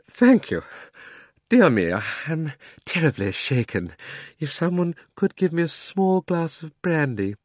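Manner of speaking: usual speaking voice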